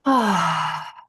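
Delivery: long, falling "ahh" of relief; relieved sigh. Acted. Teacher evokes putting feet up after a long day, relieved sigh. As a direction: sigh it out as if finally home. Acting out coming home exhausted.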